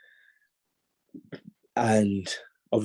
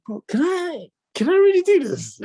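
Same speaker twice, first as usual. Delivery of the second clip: much higher, squeaky panicked inner voice; self-doubt; 5 dB louder than usual. Retold or imitated. Panicked inner thought at training.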